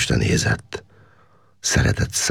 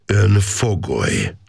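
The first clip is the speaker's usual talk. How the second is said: "Ön fogoly" slow, every word weighted and pitched higher; words pressed hard; 6 semitones higher, slower than usual. A narrator's reading. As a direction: lean heavily on "Ön" and "fogoly", slowly. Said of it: "erőteljesen megnyomva a szavakat".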